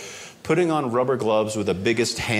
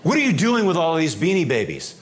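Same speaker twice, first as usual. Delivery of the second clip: higher and louder demanding question; stern customs agent; 5 semitones higher, 4 dB louder than usual. Retold or imitated. Customs agent to traveller, stern louder question. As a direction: confront the smuggler.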